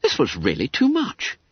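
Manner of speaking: ordinary delivery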